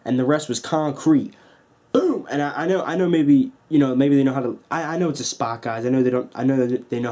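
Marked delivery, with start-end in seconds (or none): 1.9-2.2 slam sound effect. Retold or imitated. Big bulldog onto the concrete.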